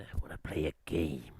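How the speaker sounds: low, whispery horror-villain rasp; creepy low whispered impersonation; Ghostface. Retold or imitated. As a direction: whisper it like a menacing phone killer. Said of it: "you do that on a voice chat".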